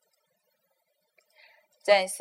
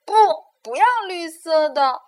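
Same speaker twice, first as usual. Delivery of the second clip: loud, higher refusal; sharp cry; 8 semitones higher, 9 dB louder than usual. Acted. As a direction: refuse the green at once. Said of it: "哈瓦尔立刻叫了起来".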